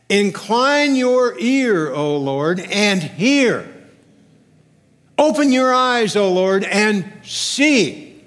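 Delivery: slow, loud, drawn-out appeal to God; impassioned plea. Retold or imitated. Preacher reads Hezekiah begging God to listen, impassioned plea. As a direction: call out to God, leaning on each command.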